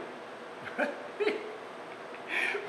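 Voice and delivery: high, breathless giggle; soft, high wheezing laugh; real laughter. Real, not acted. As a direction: crack up quietly at your own slip. Cracks up at own flub.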